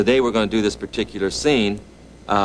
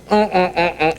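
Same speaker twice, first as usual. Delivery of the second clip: mm mm mm with lips squeezed shut; muffled mouth-held hums; 10 semitones higher, 4 dB louder, slower than usual. Retold or imitated. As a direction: hum through a mouth held closed. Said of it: "he grabs me by the mouth".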